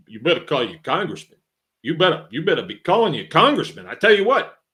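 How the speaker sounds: put-on worked-up New York accent, building in pitch and volume. Old boy nagging his friend about Congress, New York accent. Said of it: "he had a New York accent".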